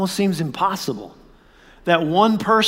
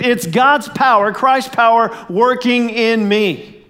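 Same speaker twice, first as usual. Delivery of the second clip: fervent recitation; 5 semitones higher, 3 dB louder than usual. Shown as a demonstration. Pastor leading the congregation in fervent recitation. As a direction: lift the verse and drive it home. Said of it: "Come on, one more time".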